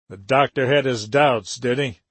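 plain reading voice

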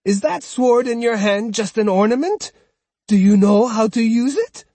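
mock his sword; taunting cry. Old woman mocking the swordsman's sword, high and loud. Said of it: "Osugi cried".

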